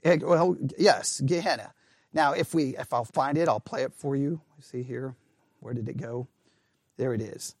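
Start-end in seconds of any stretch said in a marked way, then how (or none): none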